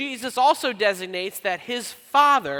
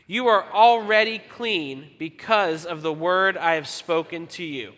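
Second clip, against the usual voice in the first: slow, measured read-aloud with deliberate gaps; group scripture reading; 4 dB louder, slower than usual. Real, not acted. Pastor leading congregation in reading aloud, slow recitation. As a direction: read scripture slowly so everyone can keep together. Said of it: "We're all gonna read it together".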